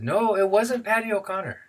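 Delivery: put-on high-pitched boyish denial; denying teenage boy. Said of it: "And the boy said".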